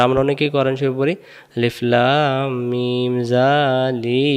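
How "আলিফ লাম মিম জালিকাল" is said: very slow, every syllable dragged long; mock of the common elongated mistake; wrongly stretched recitation. Shown as a demonstration. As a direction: drag out the whole phrase the way people wrongly do. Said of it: "সব কানে টান দেই, এটা ভুল করি".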